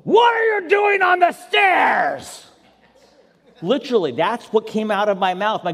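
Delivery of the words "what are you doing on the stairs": full-throated, drawn-out angry dad roar; re-enacted furious outburst; angry growling yell. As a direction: yell it high and slow with a growl. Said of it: "I yelled and I growled in a tone".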